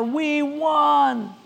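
high, drawn-out cheer without extra volume; hollered victory cheer. Acted. Home crowd hollering after the home run, cheering. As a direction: hold it out like a celebrating crowd. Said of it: "they holler".

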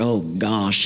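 low, bashful country voice; old farmer. Acted. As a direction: give in like a shy old farmer. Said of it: "Billy was this old farmer".

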